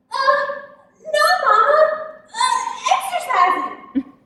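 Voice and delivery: high, loud, hesitant excuse; breathless fib. Acted. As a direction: stammer out a lie while panting. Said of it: "I'm so out of breath".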